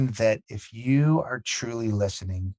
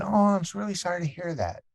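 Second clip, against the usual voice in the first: mock sympathy; 9 semitones higher than usual. Mimicking stock condolence as worse option.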